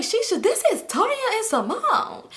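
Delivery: high, drawn-out insistent announcement; exasperated insistence. Retold or imitated. Caller telling Shisha who it is, exasperated and high. Acted phone quarrel with Shisha.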